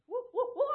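high, loud whooping into a wail; spooky record noise. Retold or imitated. Preacher mimicking dad's Halloween record, loud spooky whooping. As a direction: whoop like a Halloween sound effect. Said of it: "he had this noise going out".

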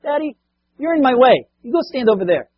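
put-on high, bossy little girl; little daughter's voice. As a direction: play the four-year-old ordering her dad aside. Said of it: "she looks up at her dad and says".

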